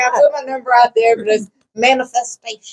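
amused, laughing humblebrag; laughing boast. Real, not acted. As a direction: brag playfully while laughing. Joking boast amid shared laughter.

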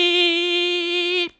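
high squeaky comic voice; squeaky punchline. Acted. Gamer squeaking the punchline about the whale. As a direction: squeak the last word for the joke.

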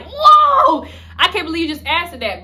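high, loud whoa, then settling; a guy's stunned reaction to a blunt question; shocked whoa. Retold or imitated. Guy reacting to her asking the friend, shocked. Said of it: "he's like, he's like".

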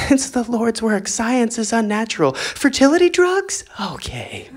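lightly voiced pious voice, whispered high on fertility drugs; prim, then sneak the exception; mock hypocrite believer. Mocking believers secretly taking fertility drugs, prim then sheepish. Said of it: "mocking believers' hypocrisy".

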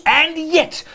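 high-pitched indignant retort; aggrieved employer pressing the point. Acted. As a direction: leap up in pitch in protest. Scrooge indignant at his clerk.

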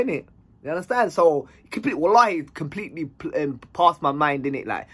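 plain reading voice

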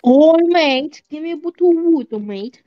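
put-on quick, playful British accent; mock British. Player demanding water from a mate, mock British. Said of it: "you are talking British".

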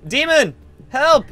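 loud, slow call for help. Acted. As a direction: shout across the hotel for the demon. Calling out to the demon.

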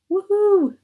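mock cheer. Sarcastic cheer about grim stories.

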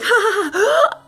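high mock laugh. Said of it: "I was like".

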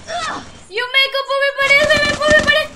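agudo y fuerte, acelerada por el juego; grito alterado y agudo; agitada. Real, not acted. Alterada en la partida. (exclamación tensa, mucho más aguda)

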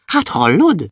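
high, glad little cry; happy shout. Acted. Boy answering the dwarfs, high and glad. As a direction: answer joyfully, voice jumping up. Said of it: "kiabálta Andris boldogan".

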